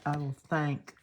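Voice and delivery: put-on repeats "I will think" in a Southern accent; "I will think" echoed with the Southern twang played up. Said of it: "Did you hear that?".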